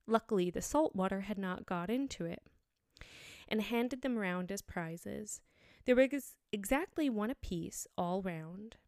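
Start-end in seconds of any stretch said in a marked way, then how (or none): none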